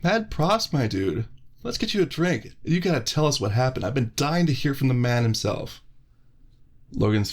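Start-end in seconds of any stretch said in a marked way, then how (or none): 0.0-5.8 much higher, cheery friendly guy; Brad's voice. Acted. Brad greeting Dominic from behind, high and cheery. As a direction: call out to a buddy you spotted, pitched up.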